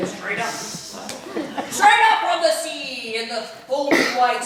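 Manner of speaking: pirate storyteller reciting verse; pirate poem reading. Acted. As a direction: recite it like an old sea dog. Reads pirate Christmas poem.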